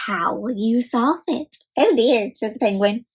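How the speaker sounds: usual speaking voice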